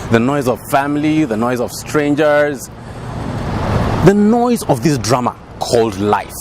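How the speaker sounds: low, measured dramatic delivery with long pauses; dramatic monologue. Acted. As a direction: brood over each phrase, pitched low. Performs a monologue.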